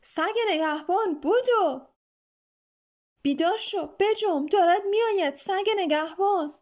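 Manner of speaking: high, loud cry of alarm; terrified yell to wake up and run; frightened shout. A narrator's reading. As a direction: shout a panicked warning. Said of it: "فریاد کشید".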